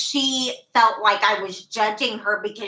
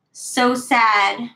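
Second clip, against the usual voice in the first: ordinary talk, nothing marked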